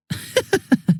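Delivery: high, soft snickering laugh; mocking laugh. Villain laughing at the self-righteous hero, soft high snicker.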